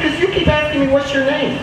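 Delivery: put-on high, matter-of-fact kid voice; boy explaining to his teacher; child explaining. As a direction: answer like an earnest little boy.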